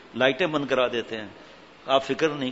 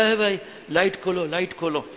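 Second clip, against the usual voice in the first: pleading cry; 4 semitones higher than usual. Retold or imitated. Fake saint begging while slapped.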